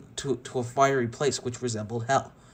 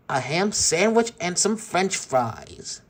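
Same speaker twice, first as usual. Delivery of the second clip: slow, higher, louder gloat; gloating bully; 6 semitones higher, 4 dB louder, slower than usual. Acted. Sid gloating at the captured toys, slow and louder. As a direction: savor each word like a cruel kid.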